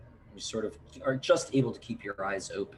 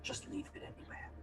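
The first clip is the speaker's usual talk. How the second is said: breathy whisper, a bit hurried; 4 semitones higher, 10 dB quieter than usual. Acted. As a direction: whisper it hastily. Aunt answers, whispered.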